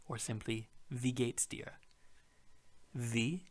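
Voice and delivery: usual speaking voice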